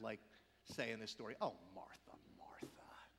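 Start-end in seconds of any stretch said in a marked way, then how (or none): 1.4-3.1 low, sighing brush-off, trailing to a hush; mock dismissive. How we often say it to Martha, mildly dismissive. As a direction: wave her off with a weary "oh". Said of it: "I do not think he says this dismissively".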